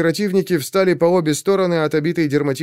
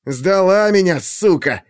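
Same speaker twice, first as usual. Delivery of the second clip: bitter accusation; 5 semitones higher, slower than usual. A narrator's reading. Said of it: "зыркнул на неё Черёмушкин".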